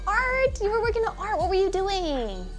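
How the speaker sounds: high, delighted squeal; cute anime streamer. Real, not acted. Delighted reaction to chat.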